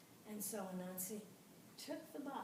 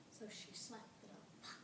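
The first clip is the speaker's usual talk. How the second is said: ordinary delivery, nothing marked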